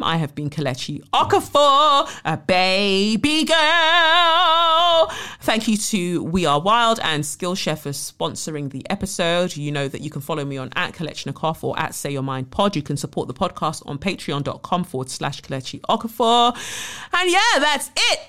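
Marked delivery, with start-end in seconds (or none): 1.1-5.1 put-on much higher, drawn-out baby-girl coo; playful baby-girl voice. Playful podcast sign-off. 17.1-18.2 high, louder playful outro; bubbly sign-off. Real, not acted.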